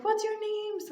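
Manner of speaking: high, lightly cooing question; talking to kids. Retold or imitated. Cashier speaking to little twins.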